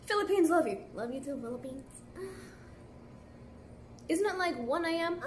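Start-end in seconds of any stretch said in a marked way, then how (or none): none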